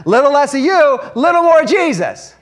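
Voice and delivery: rally the crowd with it. Real, not acted. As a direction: raise it up for the congregation. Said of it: "How about a couple more amens?".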